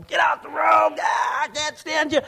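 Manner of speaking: high, loud mock shout at a driver; mock angry driver. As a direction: yell like an angry driver stuck behind someone. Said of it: "He got mad".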